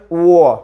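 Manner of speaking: व held long as "wa"; phonics sound of W. Shown as a demonstration. Teacher answering how W sounds, long व. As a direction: draw out व from rounded lips. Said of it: "डब्ल्यूचं काय होतोय?".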